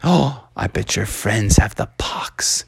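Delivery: low, slow, husky half-whisper; hushed suspicion. Acted. Crowd suspects the frog's friends, slow hushed suspicion. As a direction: say it darkly, drawn out under the breath.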